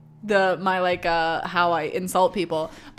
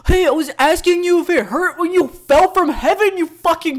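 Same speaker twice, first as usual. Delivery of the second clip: high, loud, hammed-up catcall; mock-angry street heckler; mock aggressive pickup; 9 semitones higher, 7 dB louder than usual. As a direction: yell a pickup line as abuse, dragging the ending. Imagined pickup line turned abusive.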